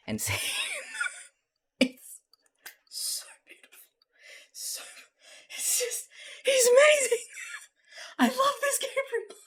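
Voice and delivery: high, squeaky words through real laughter; breaking up completely, barely able to speak; helpless laughter. Real, not acted. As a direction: gasp out praise while cracking up, voice dropping to a whisper in places. Overcome with laughter at the scene.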